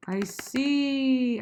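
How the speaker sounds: high, sustained "see" with extra volume; stretched out. Shown as a demonstration. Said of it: "I wanna stretch that out".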